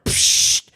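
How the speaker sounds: short hissed "psh" of a nasal spray; unvoiced burst of air; spray sound. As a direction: imitate a squirt of spray. Imitates nasal spray again.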